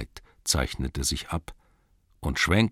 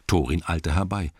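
usual speaking voice

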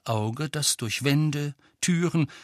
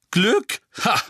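high, loud incredulous burst; scoffing; 10 semitones higher, 6 dB louder than usual. A narrator's reading. Violet-haired witch scoffing at Harry's luck, high and loud. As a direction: throw it back with a sharp ha.

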